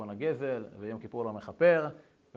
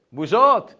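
loud, high cry of shame; a father's exasperated protest; embarrassed outburst; 6 semitones higher, 14 dB louder, slower than usual. Retold or imitated. Father protesting the son's embarrassing plan, loud and high.

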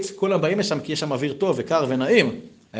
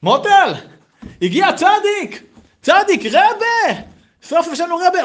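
much higher, louder, thrilled wife's voice; excited wife; 8 dB louder than usual. Acted. Wife at the window telling her husband, high thrilled. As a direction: squeal it out like an overjoyed housewife. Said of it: "היא אומרת לבעלה".

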